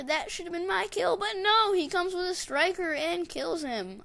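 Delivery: high, exasperated complaint; indignant outburst. Real, not acted. Gamer watching another player steal the kill, indignant outburst.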